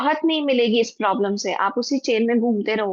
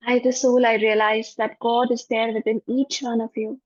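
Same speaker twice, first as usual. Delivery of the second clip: guided meditation. Acted. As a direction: guide the class gently into forgiveness. Leads a forgiveness meditation.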